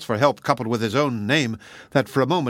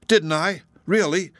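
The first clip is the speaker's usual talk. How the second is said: higher, hopeful disbelief; cried out; 6 semitones higher than usual. A narrator's reading. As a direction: exclaim in astonished questions. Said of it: "cried Worth".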